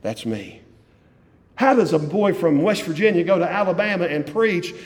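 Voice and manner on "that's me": retold murmur. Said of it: "pointing himself like".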